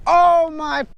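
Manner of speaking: high-pitched startled exclamation; a real startled outburst; startled cry. Real, not acted. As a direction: cry out in sudden alarm, voice leaping up. Mishap while filming, crying out.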